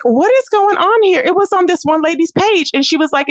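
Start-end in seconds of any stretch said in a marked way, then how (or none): 0.0-1.2 shocked disbelief. Retold or imitated. Shocked reaction to video.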